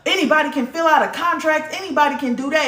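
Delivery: high, mocking voice of a dismissive parent; mock belittling parent. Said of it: "that parent get mad and be like".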